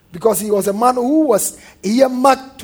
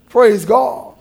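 ordinary talk, nothing marked

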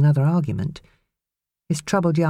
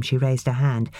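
usual speaking voice

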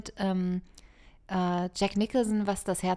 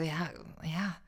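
normal talking voice

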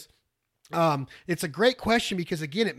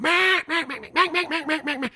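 loud high quacks, falling; standing on the first note; 10 semitones higher, 5 dB louder than usual. Shown as a demonstration. Caller hailing two hundred ducks overhead, loud quacks. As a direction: hit the first note hard. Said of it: "I'm like".

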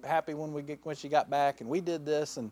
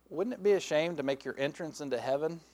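normal talking voice

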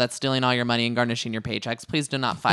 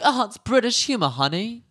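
bad British accent; 8 semitones higher than usual. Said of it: "British accent was so bad right there".

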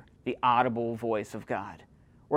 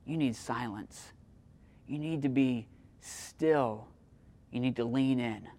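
hushed urging; 3 semitones lower, 6 dB quieter than usual. Acted. Preacher urging stillness.

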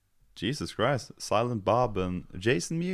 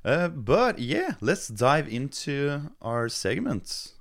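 podcast host intro; 7 semitones higher, slower than usual. Acted. Slow bright segment intro.

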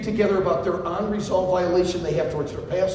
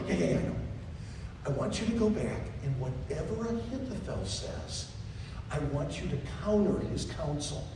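soft, low and quick; David calming Hushai, soft and low; 4 semitones lower, 12 dB quieter than usual. Acted. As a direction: hush him down gently. David quietly commanding Hushai.

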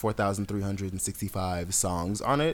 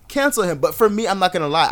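ordinary delivery

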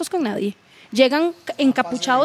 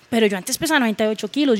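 ordinary talk

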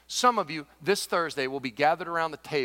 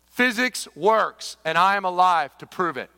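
triumphant declaration; 4 semitones higher, 12 dB louder, slower than usual. Retold or imitated. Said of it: "he says".

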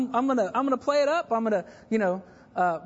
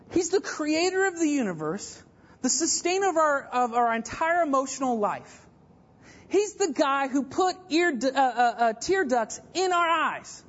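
high-pitched, drawn-out preaching proclamation; emphatic preaching; 4 semitones higher than usual. Real, not acted. Preacher telling the church who the Creator is, emphatic. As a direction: build awe with each high, stretched phrase.

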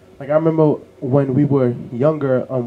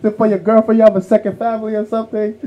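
high, loud indignant complaint; mock outrage at a wasteful husband; upset mom; 9 semitones higher, 6 dB louder than usual. Mom complaining about dad's second house, indignant and loud. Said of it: "she was upset".

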